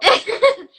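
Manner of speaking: a burst of delighted, embarrassed laughter; a giggle at the compliment; high bright laugh. Real, not acted. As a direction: laugh it off, flattered. Guest laughs at praise.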